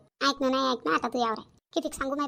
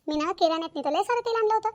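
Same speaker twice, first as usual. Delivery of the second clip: usual speaking voice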